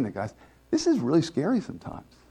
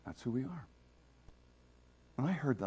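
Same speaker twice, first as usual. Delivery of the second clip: usual speaking voice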